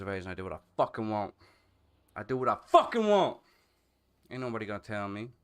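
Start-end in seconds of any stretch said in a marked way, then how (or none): 2.2-3.4 louder, defiant declaration; defiant shout. Real, not acted.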